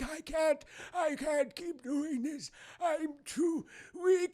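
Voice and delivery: high, slow, hammy wail; feigned weakness. Retold or imitated. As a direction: whine it out as a collapsing villain. Mimics Palpatine feigning weakness.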